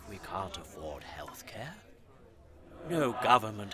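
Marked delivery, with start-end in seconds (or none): 0.1-1.7 hushed, breathy whisper. Acted. The ghost shows Paul their future, whispering the grim part. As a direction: drop to a whisper over the bleak picture. Somber narration of bleak vision.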